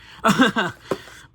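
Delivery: a short, high, bursting laugh at himself; embarrassed laugh. Real, not acted. Vlogger laughing at his own failed pronunciation, loud and high. Embarrassed after fumbling a word.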